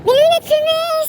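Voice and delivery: high, slow exclaimed squeal; sudden squeal. Real, not acted. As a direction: blurt it out high and stretched. Sudden loud high outburst.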